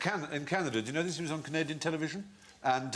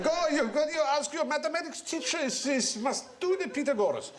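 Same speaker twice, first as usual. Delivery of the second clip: put-on much higher Hungarian accent, insistent; Hungarian grandfather; 14 semitones higher than usual. Grandfather telling the boy to ask his teacher, Hungarian. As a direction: urge him on in a Hungarian accent. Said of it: "'cause he was Hungarian".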